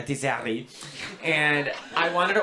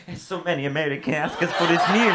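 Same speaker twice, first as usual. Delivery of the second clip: mock Italian accent on the English; imitating an Italian waiter's accent; a light Italian-accented waiter. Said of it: "the waiter goes".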